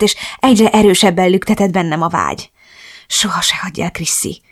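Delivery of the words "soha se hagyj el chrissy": hushed, pleading whisper. A narrator's reading. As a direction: beg softly, almost without voice. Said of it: "kérlelt, miközben". (halk, könyörgő suttogás)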